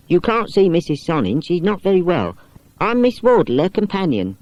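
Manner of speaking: put-on a companion politely turning boys away; Miss Wardle.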